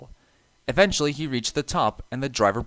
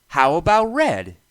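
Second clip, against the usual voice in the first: eager suggestion; 6 semitones higher, 8 dB louder than usual. A narrator's reading. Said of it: "he suggested".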